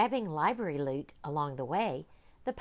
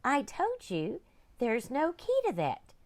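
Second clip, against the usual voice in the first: librarian voice; 8 semitones higher, 4 dB louder than usual. Acted.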